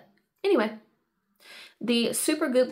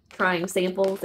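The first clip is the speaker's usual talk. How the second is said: ordinary delivery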